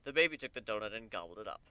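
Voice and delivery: usual speaking voice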